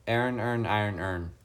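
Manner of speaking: "Iron, earn, iron, earn" run into near-identical "ern" sounds; own accented pronunciation. Shown as a demonstration. Streamer showing how they would pronounce it, accented tongue-twister. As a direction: squash each word of "Iron, earn" toward "ern". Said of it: "that's how I would pronounce that".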